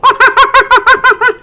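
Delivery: loud high ape hooting; gorilla hoot. Acted. Monkey man showing kids his ape call, hooting loud. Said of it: "all you do is go".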